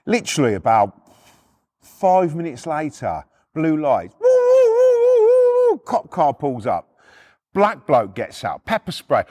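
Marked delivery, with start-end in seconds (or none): 4.2-5.8 loud, high police siren noise; high siren wail imitation. Storyteller imitating blue lights arriving, loud siren wail. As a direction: wail like a cop car siren.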